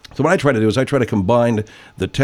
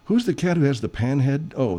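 normal talking voice

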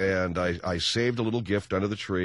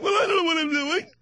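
wife's voice, high. Retold or imitated. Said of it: "You're like".

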